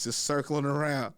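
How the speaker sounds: ordinary delivery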